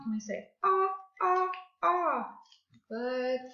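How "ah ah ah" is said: "ah" repeated as separate open vowels; vowel sound demo. Shown as a demonstration. Teacher reviews the week's phonics with class, repeated "ah" demo. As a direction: sound out "ah" beat by beat. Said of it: "our phonics is the ah sound".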